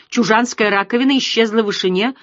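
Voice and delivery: ordinary delivery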